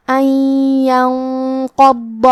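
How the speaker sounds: ان ینقض with the noon merged into the ya and a long nasal hum, very slow; idgham with ghunnah. Shown as a demonstration. Teacher demonstrating ان ینقض for the student, slow and nasal. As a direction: stretch ان ینقض into one nasal, doubled ya. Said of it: "یہاں بھی حکم ہے ادغام بغنہ".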